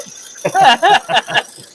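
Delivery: a sudden squealing cackle; high loud laugh burst. Real, not acted. Laughing at snoring story.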